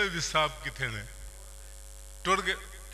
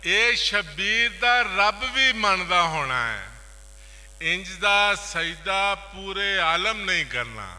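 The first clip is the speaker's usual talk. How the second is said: measured opening, then higher, ringing recital; poetry recitation; 3 semitones higher, 7 dB louder than usual. Acted. Poet reciting a couplet on Shabbir, declaimed. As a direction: build from a first reading to full declamation.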